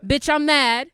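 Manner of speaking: full-force slam poetry shout; shouted refrain. Acted. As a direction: belt it out so they answer back.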